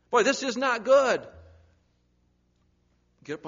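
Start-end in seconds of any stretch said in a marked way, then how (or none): 0.1-1.3 higher, louder, slow lament; alarmed outcry. Retold or imitated. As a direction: sound troubled. Voicing worried believer.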